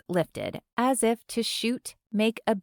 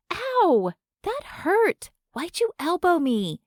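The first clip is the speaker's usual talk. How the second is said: much higher, yelping in pain and annoyance; indignant yelp after an elbow; elbowed fan; 10 semitones higher than usual. A narrator's reading. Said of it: "another voice says".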